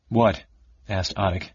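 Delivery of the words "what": ordinary delivery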